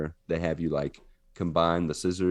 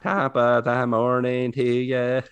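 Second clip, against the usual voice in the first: slow, higher Irish robot voice; 5 semitones higher, 4 dB louder, slower than usual. Shown as a demonstration. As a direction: stretch it out as a mechanical Irish robot. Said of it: "Do the Irish robot".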